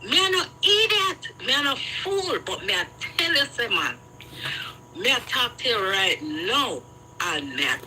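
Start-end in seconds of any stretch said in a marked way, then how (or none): 0.0-2.4 high, affronted protest; indignant protest. Real, not acted. Protesting to her friend she is no fool, indignant. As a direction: bristle at being called a fool.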